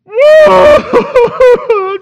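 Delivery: very loud, high wail of fake crying; mock bawling. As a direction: burst into hammed-up sobbing.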